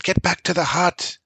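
shout. A narrator's reading. Father shouting at his children in the storm, high. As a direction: yell over the storm at the kids. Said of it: "shouted Bran".

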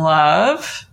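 "love" drawn out with a firm push; "love" isolated and emphatic; stress on LOVE. Shown as a demonstration. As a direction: lean hard into "love" and hold it.

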